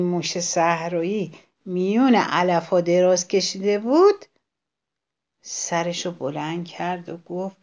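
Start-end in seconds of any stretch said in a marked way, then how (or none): none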